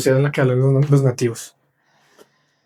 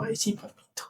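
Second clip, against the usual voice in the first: cooing mother; 7 semitones higher, 16 dB quieter than usual. Retold or imitated. Teachers mothering, cooing retold.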